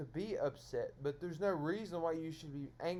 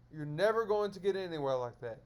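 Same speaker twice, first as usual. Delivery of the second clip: ordinary delivery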